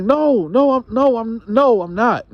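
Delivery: wife denying to her husband, flustered and high; flustered denial. Retold or imitated. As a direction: scramble to deny it. Wife denies cheating, voiced by narrator.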